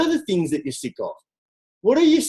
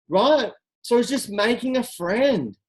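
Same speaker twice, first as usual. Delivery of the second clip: ordinary talk, nothing marked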